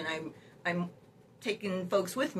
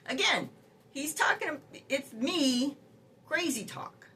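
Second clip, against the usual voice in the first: indignant; 7 semitones higher, slower than usual. Real, not acted. Indignant at AI title.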